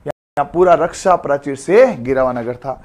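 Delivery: ordinary talk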